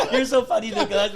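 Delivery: loud, high and laughing; teasing him through laughter; laughing tease. Retold or imitated. Comedians teasing him, loud with laughter.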